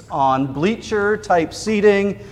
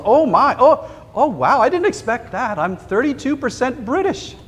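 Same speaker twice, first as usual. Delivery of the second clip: higher, startled reading of the results; gasping surprise; 8 semitones higher than usual. Retold or imitated. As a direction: caught off guard by the news. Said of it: "inhales and gasps".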